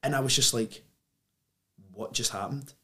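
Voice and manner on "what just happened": very soft, stunned question; retold daze. Opening his eyes after the trip, hushed bewilderment. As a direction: say it barely above a murmur, as if just come round.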